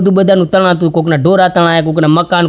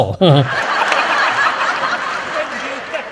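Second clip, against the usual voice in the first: soft laugh; 5 semitones higher, 7 dB quieter than usual. Real, not acted. Laugh after punchline.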